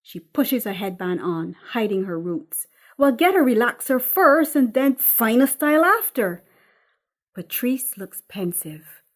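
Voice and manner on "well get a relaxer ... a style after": louder, higher, matter-of-fact order; bossy mother. Acted. Mother instructing her daughter to get a relaxer, loud and bossy. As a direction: tell her what to do like a bossy mum.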